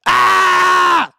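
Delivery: sudden piercing yell into the mic; loud yell. Real, not acted. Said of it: "I hope that ruptured at least one person's eardrum".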